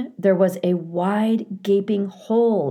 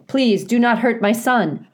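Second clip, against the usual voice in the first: higher, louder urgent plea; begging; 5 semitones higher, 6 dB louder than usual. A narrator's reading. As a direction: plead for your child's life. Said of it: "begged Roz".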